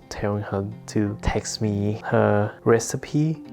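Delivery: usual speaking voice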